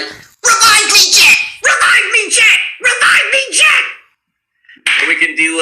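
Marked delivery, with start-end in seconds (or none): 0.0-3.8 very high, loud cartoon rabbit cry; Bugs Bunny. Voice actor plays a dying Bugs in a game, cartoon rabbit shout. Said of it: "if Bugs is playing, he'd be like".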